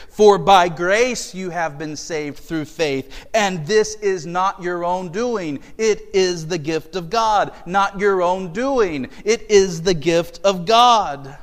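slow, loud, emphatic scripture reading; preaching at full force. Acted. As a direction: drive each phrase home slowly.

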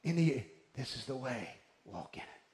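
low, hushed, trailing into a whisper; whisper in the ear. Retold or imitated. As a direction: murmur it close to an ear. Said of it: "he whispers in your ear and says".

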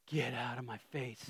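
whispered get out of my face; mock hushed shoo. Parent whispering to kids to go away. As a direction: hiss it like a tired parent. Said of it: "What I tell my kids".

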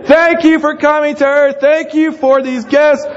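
high, strained mock-shouted grace; yelled prayer. Dad at the dinner table so other kids can hear, yelling prayer. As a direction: holler each line of the blessing over a screaming kid. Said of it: "you are yelling your prayer".

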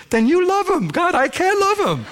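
high, loud, laughing mock whine; whiny protest to God. Preacher mock-whining back at God with a laugh. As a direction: play yourself as a squeaky, exasperated kid pushing back. Arguing with God in retold prayer.

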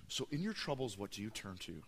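low, quiet, intimate ask; soft question. Acted. Preacher asks the church again, quiet and close. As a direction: let the question sink in gently. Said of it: "quiet repeat of the question".

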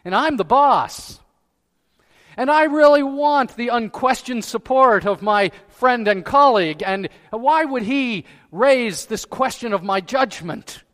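loud, high, hammed-up outrage; proud indignation. Preacher playing his proud self questioned by a colleague, indignant. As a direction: protest like an affronted boss. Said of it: "because I'm proud".

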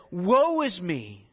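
drawn-out "Woe is me"; slow, grave "Woe is me"; slow lament. Retold or imitated. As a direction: stretch each word like a lament. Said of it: "he said".

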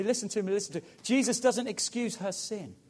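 usual speaking voice

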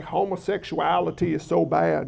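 low mock Southern accent; imitating a disapproving Southerner's accent. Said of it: "say it with a Southern accent".

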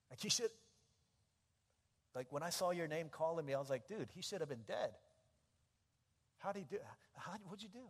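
high-pitched, stumbling disbelief; incredulous friend. Acted. Friend at Starbucks asking how he got out, incredulous. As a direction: blurt it out in astonished bursts. Friend astonished he is still alive.

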